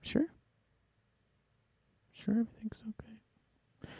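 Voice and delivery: soft whispered teasing question. Real, not acted. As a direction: whisper it, high and playful. Teasing check on co-host.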